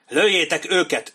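loud, high officer's yell; bellowed order. A narrator's reading. An officer to idle redcoats across the ravine, bellowing. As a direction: roar the command to open fire. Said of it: "üvöltött egy tiszt".

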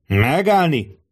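high, drawn-out halt command; narrated bellow. A narrator's reading. Centurion halts the bodyguards, acted bellow. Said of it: "üvöltött rá Kato".

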